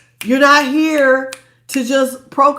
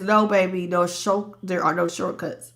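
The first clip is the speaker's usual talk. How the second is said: gentle universe voice; 4 semitones lower, 6 dB quieter than usual. Retold or imitated. Universe answers tenderly.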